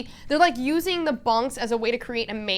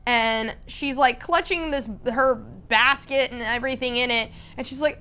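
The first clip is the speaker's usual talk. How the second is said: ordinary delivery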